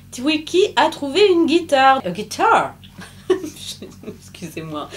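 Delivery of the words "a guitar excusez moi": laughing through the word; a giggle breaking into guitar. Real, not acted. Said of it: "je ris de mes propres fausses blagues".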